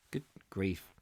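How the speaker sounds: a hushed aside of disbelief; whisper. Real, not acted. Reader reacting to blasting damage, whispered aside.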